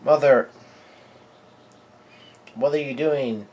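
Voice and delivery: half-hearted cry to mother; retold yell. Kids yelling to Mother Caramel, mild mock yell. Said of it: "yelling out".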